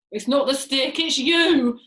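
loud, emphatic gloating, landing on "you"; gloating grin. A narrator's reading. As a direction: pin it on her with a horrible grin. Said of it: "grinning horribly".

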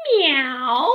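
loud drawn-out meow; cat meow. Acted. George the pup answering his mother, loud cat meow. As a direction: mimic a cat with gusto. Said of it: "And George went".

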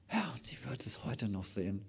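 hushed aside; soft whisper. Real, not acted. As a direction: whisper it to the congregation. Aside during sermon.

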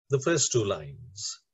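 ordinary talk

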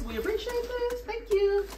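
very high, dainty thank-you; singsong thanks. Real, not acted. Vlogger thanking the brand for a garment bag, singsong. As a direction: lilt it high and sweet.

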